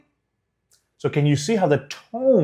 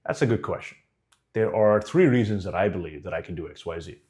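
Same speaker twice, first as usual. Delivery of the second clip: composed, lower-pitched, measured answer; slow and controlled; 7 semitones lower, 3 dB quieter than usual. Acted. Candidate calmly answers why to hire them, controlled and assured. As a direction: take your time and answer unfrazzled. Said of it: "Notice how slow and controlled".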